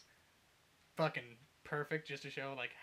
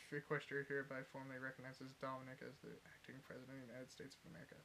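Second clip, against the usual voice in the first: reading to oneself; 11 dB quieter, faster than usual. Retold or imitated. Ruler finds a notice on her desk, reading it quietly. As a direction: read the document quietly, without emphasis. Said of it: "Reads it again".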